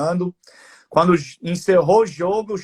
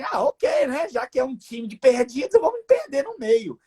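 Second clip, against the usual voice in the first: put-on excited boy; 10 semitones higher than usual. Coach voices the shy boy suddenly cheering up. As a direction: burst out like a kid who finally feels included. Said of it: "tipo assim".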